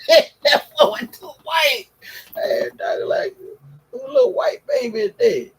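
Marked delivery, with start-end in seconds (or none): 0.1-1.9 high, loud, laughing wail; laughing cry. Retold or imitated. Repeats it laughing, crying out. 3.9-5.5 much higher incredulous question; high squeal. Retold or imitated. Retold question to doctor.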